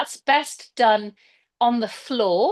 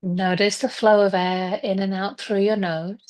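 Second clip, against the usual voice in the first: guided meditation. Acted. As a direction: ease them gently into stillness, leaving space between phrases. Leads a breathing and visualization meditation.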